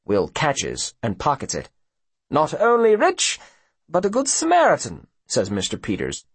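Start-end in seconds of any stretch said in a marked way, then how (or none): 2.3-5.1 high, swooping mock politeness; condescending gentleman with theatrical relish; smug rich villain. A narrator's reading. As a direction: purr false compliments in a lofty high voice. Voices smug Mister Peters.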